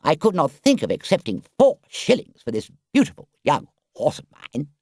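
high, loud, boastful Toad; a vain toad feigning outrage, then angling for a price; Toad's voice. Acted.

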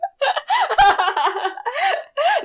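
high giggling fit; real high laugh; burst of laughter. Real, not acted. Big laugh at the characters.

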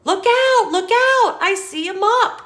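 high, loud warning cry; alarmed call. Acted. Book animals spotting the mop, calling out in alarm. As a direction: shout a warning as danger comes.

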